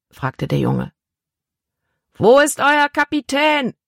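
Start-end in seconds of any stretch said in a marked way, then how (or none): 2.1-3.7 put-on high, forceful yell at the boy; bellowing. Big man shouts at boy on deck, bellowing. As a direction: roar the question up at the deck. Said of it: "brüllte der große Mann".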